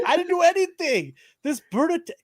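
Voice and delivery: very high, defensive retold denial; mock protesting. Narrator defends himself to his friend over the bird, mock protesting. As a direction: protest innocence high up, cut off at the end.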